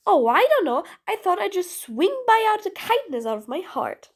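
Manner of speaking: mock-casual sarcasm, pitch swooping up; sarcastic. Villain answers the prisoner's question with false sweetness, sarcastic. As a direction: lay the sarcasm on thick. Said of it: "I was being sarcastic".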